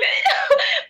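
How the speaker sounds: a high laugh that cracks into crying; laughing as tears come; laugh into sob. Real, not acted.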